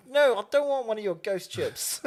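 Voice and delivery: put-on high, loud protest in rural Māori accent. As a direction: imitate the ad's rural Māori refusal loudly. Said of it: "the way I was talking right then".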